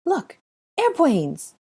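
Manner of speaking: put-on high, loud little boy pointing in wonder; excited child. A narrator's reading. Small boy to Hermione, pointing at memos, excited and high. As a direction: squeal it out like a thrilled toddler. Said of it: "pointed excitedly".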